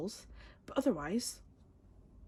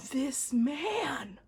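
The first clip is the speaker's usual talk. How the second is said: high whisper; breathless whisper, higher up; high excited whisper; 8 semitones higher, 3 dB louder than usual. Real, not acted. Awed at photo.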